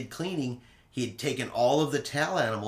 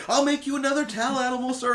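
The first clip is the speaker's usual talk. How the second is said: eager steward; 12 semitones higher, 4 dB louder, faster than usual. Retold or imitated. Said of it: "he was even like".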